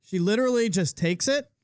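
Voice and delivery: ordinary talk, nothing marked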